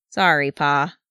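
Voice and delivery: small, contrite apology; lightly voiced guilty youngster; chastened child. A narrator's reading. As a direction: own up sheepishly to Pa. Child apologizes to stern father.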